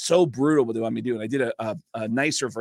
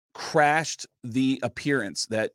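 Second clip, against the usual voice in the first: usual speaking voice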